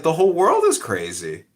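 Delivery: venting that the world is crazy; worked-up rant. Real, not acted. Telling his friend about the park, worked up.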